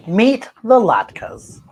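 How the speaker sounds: usual speaking voice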